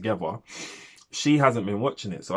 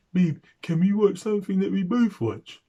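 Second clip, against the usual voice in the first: high, louder put-on partner voice; imitating missus; 10 semitones higher, 4 dB louder than usual. Missus interrupting his binge-watch to ask him, mock high pleading voice. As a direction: mimic a partner's high-pitched plea. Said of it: "she'll come and sit down and be like".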